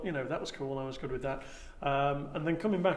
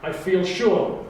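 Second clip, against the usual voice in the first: rousing Time Lord oration, building loud; loud, stately audition monologue; grand speech; 3 semitones higher, 7 dB louder than usual. Acted. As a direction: declaim it like a proud lord rallying his people.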